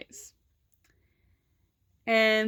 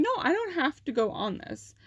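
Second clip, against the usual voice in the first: higher-pitched retold protest; insistent refusal; 7 semitones higher than usual. Student to vice principal pressing her to go, insistent. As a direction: push back, mildly indignant.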